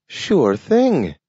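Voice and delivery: high, talking dog; a dog speaking in a high voice. A narrator's reading. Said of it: "said Nathaniel".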